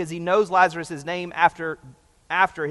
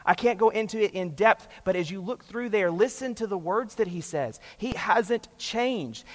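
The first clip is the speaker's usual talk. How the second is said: ordinary delivery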